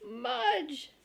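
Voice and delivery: high, loud yell of the name. Acted. Henry calling out as Mudge shakes water. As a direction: shout at the dog in dismay.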